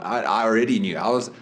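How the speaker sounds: normal talking voice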